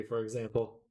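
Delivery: normal talking voice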